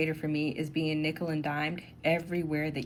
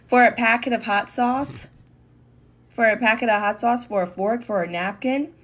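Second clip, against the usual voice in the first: high, loud disbelief, rising on each item; incredulous; 4 semitones higher, 5 dB louder than usual. Real, not acted. Customer complaining about restaurant upcharges, loudly incredulous. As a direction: list each item as an outraged question. Protesting charges for small items.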